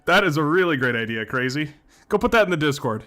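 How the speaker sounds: loud, laughing delight; thrilled by a great idea; excited. Real, not acted. Excited about viewer idea.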